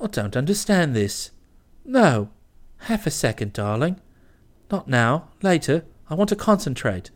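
brushing her off while he reads, low and muttered; distracted young man. A narrator's reading. Kipper waves off clinging Bobby, low and distracted.

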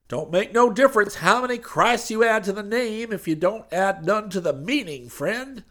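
high, loud, wounded preacher voice; hurt preacher. Acted. Preacher hurt by Hayes, high and loud. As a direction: sound hurt but keep preaching. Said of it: "in a hurt tone".

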